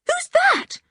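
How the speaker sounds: put-on high, startled girl's voice; surprised girl asking; little girl voice. Anne's voice.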